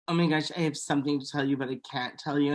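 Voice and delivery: quick, hushed, coy secret-keeper; hushed teasing. Retold or imitated. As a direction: tease with a secret, fast and low. Imitating a teasing friend.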